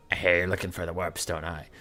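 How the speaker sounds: low goblin voice. Acted. Goblin coming by to offer help, low creature voice. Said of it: "Then a goblin comes by".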